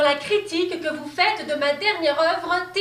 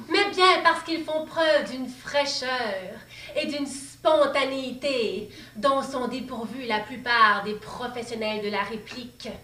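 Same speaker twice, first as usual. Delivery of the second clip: normal talking voice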